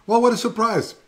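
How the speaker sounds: high, loud happy greeting; light up at the new arrival; delighted surprise. Real, not acted. Greeting a viewer who joined.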